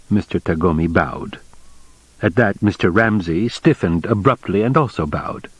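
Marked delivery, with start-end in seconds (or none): none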